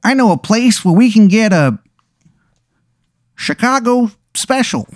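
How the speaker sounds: high-pitched, put-on Chicago wizard; hammed-up Chicago accent, high and halting; terrible Chicago accent. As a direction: lift it high in a badly faked Chicago accent. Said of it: "he's from Chicago".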